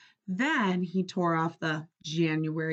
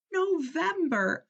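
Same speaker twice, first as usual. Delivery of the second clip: ordinary delivery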